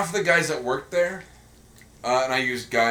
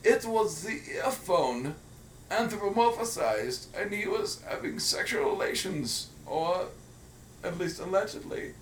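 put-on German accent; 5 semitones higher, 5 dB quieter than usual. Co-host describing the earphone commercial, hammed-up German accent. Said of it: "pay off the talk about accents".

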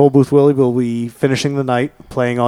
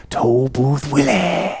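slow, stretched-out announcement; drawn-out name; much slower than usual. Shown as a demonstration. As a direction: draw out Toll Booth Willie slowly. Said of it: "I'm gonna say it like that".

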